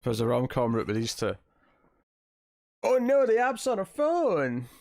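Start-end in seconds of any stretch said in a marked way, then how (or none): 2.8-4.7 high, slow dismayed cry. Real, not acted. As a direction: groan it out, high and drawn out. Dismayed reaction to movie scene.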